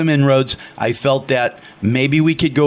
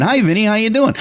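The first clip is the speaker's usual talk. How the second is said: higher-pitched, oblivious cheery hello; lightly acted naive greeting; clueless friendly greeting; 8 semitones higher than usual. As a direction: greet him like a happy bonehead, pitch up. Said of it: "sitting there like a bonehead going".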